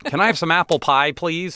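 put-on high, fast, all-American kid voice; dopey American. Asking the consulate staff in a high, goofy American voice. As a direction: ham up the apple-pie American.

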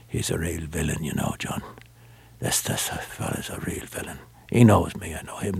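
hushed, breathy whisper of a conspiring detective; retold whisper. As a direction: lean in and whisper it like a secret.

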